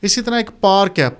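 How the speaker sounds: ordinary delivery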